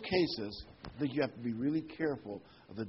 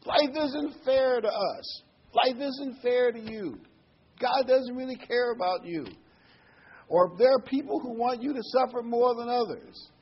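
high, loud taunting devil voice; preacher voicing the devil's lies in a high mocking tone; 11 semitones higher, 8 dB louder than usual. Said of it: "The devil is whispering something like".

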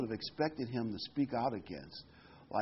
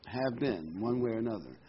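ordinary delivery

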